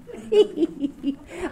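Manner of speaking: high bright burst of laughter. Real, not acted. Remembering a scary teacher, high laugh.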